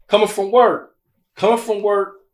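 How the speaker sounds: getting heated listing his long day; worked-up rant. Real, not acted. Man venting to his podcast guest about work, heated rant.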